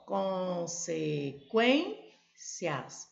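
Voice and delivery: consequências stretched slowly into syllables. Shown as a demonstration. Teacher reviewing the split from earlier. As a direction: draw out consequências beat by beat. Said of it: "Agora, consequência".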